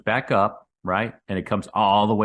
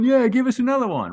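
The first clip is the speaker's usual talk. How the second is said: much higher, a bit louder, hammed-up cheer; mock eager crowd; 4 dB louder than usual. Mocking followers begging the guru for more, high hammed-up cheer. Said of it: "more and more come flocking back and going".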